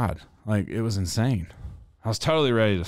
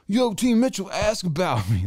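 high-pitched hollered boast, mimicked; mock yelling; 11 semitones higher than usual. Skier retelling a stranger's lift-line boast, mock yelling. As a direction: imitate a loudmouth yelling across the lift line. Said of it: "this dude was yelling".

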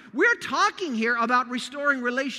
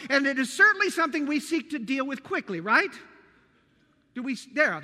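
ordinary talk, nothing marked

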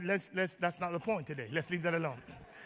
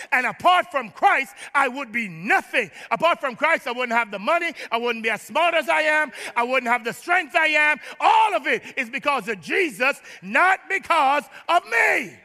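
building to a higher, louder shout; impassioned preaching; 3 semitones higher, 3 dB louder than usual. Real, not acted. Preacher crediting Christ for everything, rising full-force proclamation. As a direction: preach it in driving phrases, drawing out the last.

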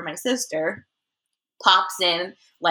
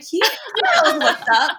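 laughing; 7 semitones higher than usual. Retold or imitated. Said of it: "She was like".

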